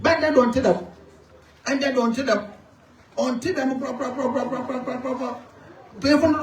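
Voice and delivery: a chief rousing his people in Fante; impassioned public speech. Acted.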